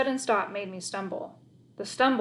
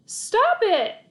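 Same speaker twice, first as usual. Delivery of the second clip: loud, high yell; desperate cry; bawled; 12 semitones higher, 8 dB louder than usual. Acted. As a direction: bawl at the dogs. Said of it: "I bawled".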